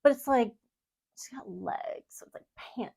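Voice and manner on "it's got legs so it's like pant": soft breathy whisper. Real, not acted. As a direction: drop to a hushed whisper. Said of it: "drops to a whisper mid-description".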